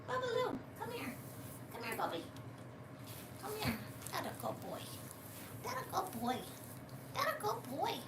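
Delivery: high, soft doggy talk. Real, not acted. Calling her dog Bubbaloo over, soft baby talk.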